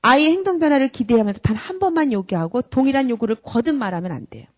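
ordinary delivery

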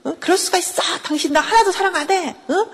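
high-pitched tirade of accusations; angry mock yelling. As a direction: imitate a wife raging at her husband. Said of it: "막 그러면서 화를 내고 소리를 지르게".

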